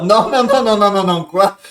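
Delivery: loud high repeated no; real panic, loud and high; loud panicked no. Real, not acted. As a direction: shout no at the screen. Character about to die.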